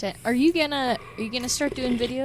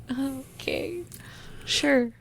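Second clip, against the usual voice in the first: hesitant agreement; 5 semitones higher, 4 dB quieter, slower than usual. Retold or imitated. Retold reluctant agreement to post.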